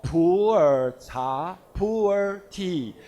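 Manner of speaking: "pu'er" said the Chinese way, poo-er, slowly; Mandarin pronunciation. Shown as a demonstration. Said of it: "In typical Chinese pronunciation, Mandarin pronunciation".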